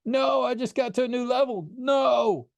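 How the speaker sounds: high desperate no, no; a kid dragged away from his game, yelling; shouting in protest. A narrator's reading. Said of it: "as he shouted".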